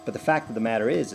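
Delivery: usual speaking voice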